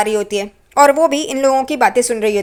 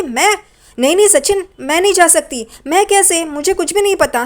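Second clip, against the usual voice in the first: higher, flustered protest; startled refusal; 6 semitones higher than usual. Retold or imitated. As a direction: push the idea away in alarm. Saili refuses to go herself. (घबराकर हैरानी से मना करती हुई)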